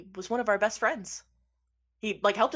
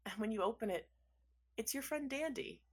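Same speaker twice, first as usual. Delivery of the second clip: ordinary talk, nothing marked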